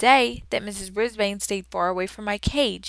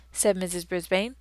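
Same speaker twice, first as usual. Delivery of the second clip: ordinary delivery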